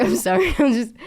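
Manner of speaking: high, loud mock-defensive apology; apologetic. Retold apology to puzzled coworkers.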